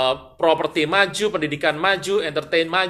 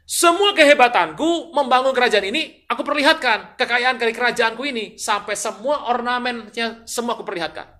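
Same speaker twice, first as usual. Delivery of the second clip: high, proud proclamation, easing in volume; acted boastful king; 8 semitones higher, 6 dB louder than usual. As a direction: show off everything you own. Acts king boasting of his riches. (lagak raja pamer kekayaan)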